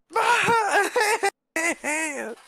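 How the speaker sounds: high wailing cry; mock wailing. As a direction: wail like a broken robot. Rudy bawling.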